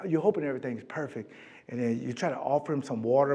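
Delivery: ordinary talk, nothing marked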